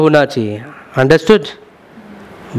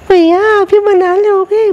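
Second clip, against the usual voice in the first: much higher, sweetly coaxing client voice; sweet pleading client. Retold or imitated. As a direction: play the client asking her stylist nicely. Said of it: "कई बार client बहुत प्यार से बोलते हैं". (बहुत प्यार से, मीठी ऊँची आवाज़ में)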